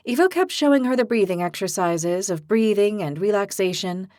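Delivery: ordinary delivery, nothing marked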